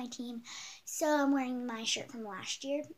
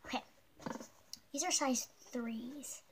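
ordinary talk, nothing marked

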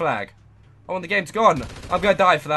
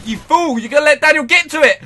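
rising panicked shouts at his teammate; frantic yell; 9 semitones higher, 9 dB louder than usual. Real, not acted. Gamer shouting at teammate as Daniel closes in, panicked. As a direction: yell in alarm as Daniel nears the flag.

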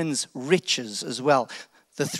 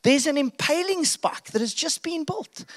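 sly servant; 10 semitones higher than usual. Acted. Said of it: "One of the guys go".

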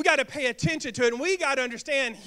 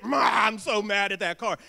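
hammed-up shout at the car; mock angry shout; 4 semitones lower than usual. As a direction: play a driver raging at his car. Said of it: "You get all mad at it".

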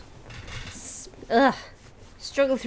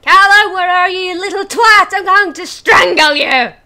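mock furious yelling; angry shout; 8 semitones higher, 9 dB louder than usual. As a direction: bellow it like an enraged parent. Yelling at the game character.

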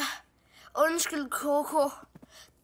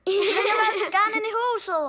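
1 pleading wolf; 3 semitones higher, much faster than usual. Pretend. Wolf begging to come in.

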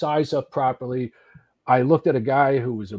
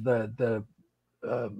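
ordinary talk

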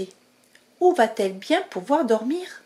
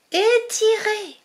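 straining effort; 10 semitones higher, 4 dB louder, slower than usual. Acted. Mouse straining at rope.

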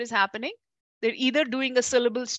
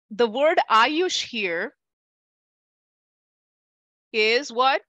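ordinary talk

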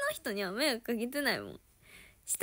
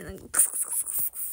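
fast breathy kusu-kusu rustle; rustling sound; 4 semitones lower, 9 dB quieter, faster than usual. Retold or imitated. Imitating a cockroach scuttling, fast rustle. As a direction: whisper the kusu-kusu rustle quickly.

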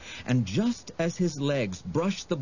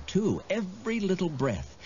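ordinary delivery, nothing marked

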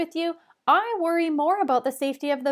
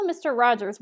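ordinary delivery, nothing marked

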